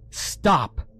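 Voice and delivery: sharp high command; commanding shout; ordering. A narrator's reading. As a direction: order the creatures to halt. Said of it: "I ordered".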